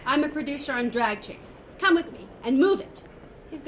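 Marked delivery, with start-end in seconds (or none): 1.8-3.1 barking order. Acted. Producer hurries contestant.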